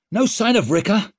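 high, loud, sharp demand; snapped question. A narrator's reading. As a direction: snap it out over the storm. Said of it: "snapped Leo".

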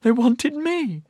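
high, slow, tearful; sobbing. A narrator's reading. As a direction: sob it out, stunned. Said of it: "she sobbed".

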